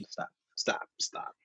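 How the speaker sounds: normal talking voice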